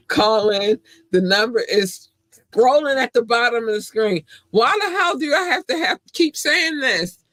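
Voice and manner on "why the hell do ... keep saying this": high frustrated wail; exasperated whine. Real, not acted. Exasperated complaint to Doc.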